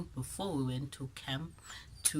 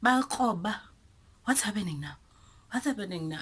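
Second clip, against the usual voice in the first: higher, louder mocking crowd; lightly voiced teasing classmates; 6 semitones higher, 6 dB louder, faster than usual. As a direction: call out like nosy classmates. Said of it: "people kept on".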